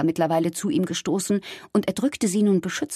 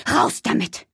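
rough, forceful order; urgent command. Acted. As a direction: order the child urgently to spit it out. Mother orders child to spit out. (energischer, rauer Befehl)

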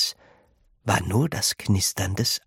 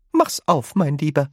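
pleading; 6 semitones higher than usual. A narrator's reading. Grandma Georgina begs Charlie to open it, pleading high. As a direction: beg him sweetly to open it. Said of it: "flehte Grandma Georgina".

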